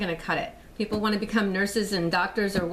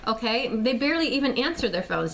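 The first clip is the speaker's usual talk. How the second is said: usual speaking voice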